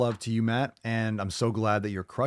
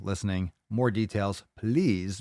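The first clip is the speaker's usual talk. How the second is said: normal talking voice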